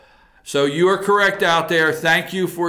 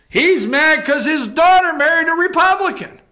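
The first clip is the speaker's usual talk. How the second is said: animated telling; 11 semitones higher, 4 dB louder than usual. Real, not acted. Animated story about angry grandfather.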